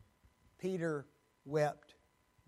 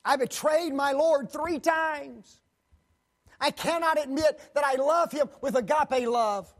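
ashamed Peter; 8 semitones higher, 10 dB louder than usual. Acted. Peter confessing his shame.

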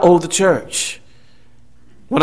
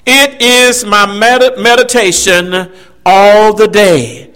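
slow, savoring quote; slower than usual. Retold or imitated. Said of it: "Look what he says".

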